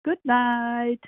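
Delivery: usual speaking voice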